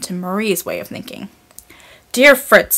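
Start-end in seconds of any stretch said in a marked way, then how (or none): 2.1-2.8 firm address. A narrator's reading. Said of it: "said the judge".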